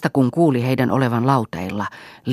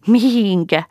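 high, teasing giggly ask; high tittering question; giggling question; 10 semitones higher, 6 dB louder, slower than usual. A narrator's reading. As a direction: tease him with a giggle. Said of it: "hihitti Juha".

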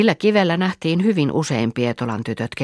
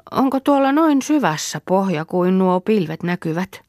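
put-on high, curious little girl voice; awed child; wondering child; 5 semitones higher than usual. Child peering into water.

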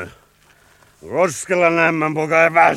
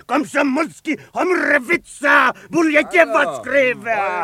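put-on drunken rant; 5 semitones higher than usual. Drunk soldier ranting mock Russian.

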